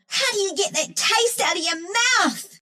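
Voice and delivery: high-pitched revulsion; disgusted complaint. Acted. Disgusted by a taste.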